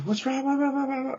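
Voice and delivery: high, mocking imitation of a worried girl; mimicking her friend. Teller mocks friend asking what's wrong, high mock voice. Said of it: "And she's like".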